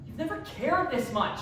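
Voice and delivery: exasperated retort. Acted. Friend snapping back.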